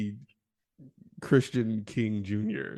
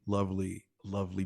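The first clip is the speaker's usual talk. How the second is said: normal talking voice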